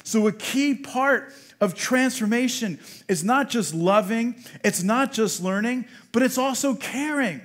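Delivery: loud, emphatic sermon delivery; preach. Real, not acted. Pastor urging the congregation to care for the lonely, forceful preaching. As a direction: preach it with force, driving each point home.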